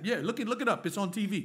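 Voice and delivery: usual speaking voice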